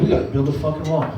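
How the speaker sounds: low, fast grumbling rant. Retold or imitated. Relatives ranting low and fast about the wall. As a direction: rant like an angry uncle. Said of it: "They're like".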